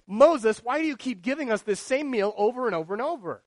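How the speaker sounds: griping crowd to Moses; mock complaint. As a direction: whine at Moses. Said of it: "grumbling and saying".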